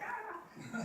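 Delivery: sudden high yelp to startle someone; playful high-pitched scare cry; scare yell. Retold or imitated. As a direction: jump out with a high shriek. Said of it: "be like".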